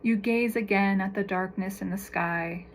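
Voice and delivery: calm, measured meditation voice with long pauses; guided meditation. Real, not acted. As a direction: guide them gently through each breath and image, unhurried.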